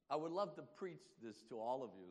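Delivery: normal talking voice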